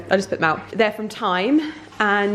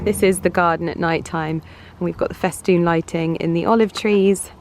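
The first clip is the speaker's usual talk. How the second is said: normal talking voice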